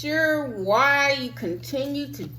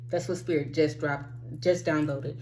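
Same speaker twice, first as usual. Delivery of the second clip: usual speaking voice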